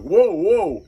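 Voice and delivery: startled by a sniffing dog; alarmed whoa. Retold or imitated. Nervous man backs off from the dog, startled loud whoa.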